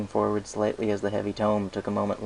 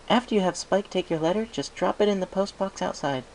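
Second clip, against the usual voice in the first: higher, brisk instructing mare; higher, businesslike Twilight; Twilight's voice; 11 semitones higher than usual. Acted. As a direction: give quick directions, higher. Twilight gives directions.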